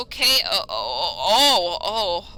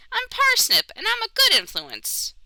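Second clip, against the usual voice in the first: much higher, chirpy bunny character; high rabbit voice; 12 semitones higher than usual. Acted. Player voicing Parsnip to a stranger, high cheerful rabbit. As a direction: play a cheerful little rabbit.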